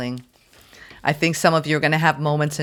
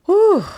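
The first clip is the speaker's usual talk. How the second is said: whew; 7 dB louder than usual. Real, not acted. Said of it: "my God".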